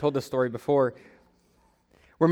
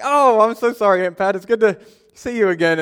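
higher, mock-effusive apology; gushing apology; 5 semitones higher, 4 dB louder than usual. Apologizing to the great aunt he forgot, gushing. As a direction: overdo the sorry to smooth it over.

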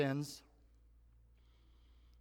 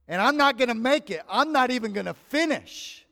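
louder, higher, anguished cry of giving up; raised, despairing retold wail; 8 semitones higher, 8 dB louder than usual. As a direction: relive the moment you knew you'd fail. Retelling despair at mile twenty-five.

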